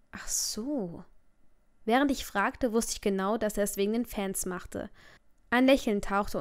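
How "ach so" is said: whispered realisation. Acted. Realising why he's disguised.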